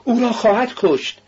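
high-pitched cry of alarm; alarmed shouting. A narrator's reading. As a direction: cry out high like a shocked onlooker. (فریاد زیر و هراسان)

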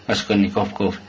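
plain reading voice

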